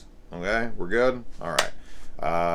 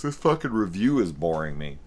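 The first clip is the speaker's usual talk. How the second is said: ordinary delivery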